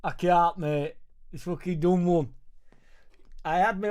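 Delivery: a hammed-up furious Gav; angry mad Gav. Streamer doing angry mad Gav when a viewer asks, mock fury. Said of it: "[name] gives the angry mad Gav".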